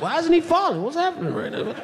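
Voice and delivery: high, baffled disbelief; can't believe he's still standing; stunned self, voice jumping up. Retold or imitated. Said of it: "I'm like".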